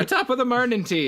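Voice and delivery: high, loud mock Irish accent; hammed-up Irish accent greeting. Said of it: "That's always your Irish things".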